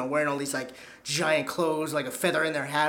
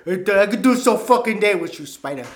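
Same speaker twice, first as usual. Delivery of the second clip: pimp voice; 4 semitones higher, 7 dB louder than usual. Retold or imitated. Quoting Slickback pimp clip.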